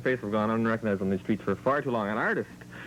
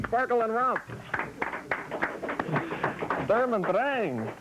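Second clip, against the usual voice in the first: announcer voice; 11 semitones higher, slower than usual. Acted. Grand announcer intro of an act.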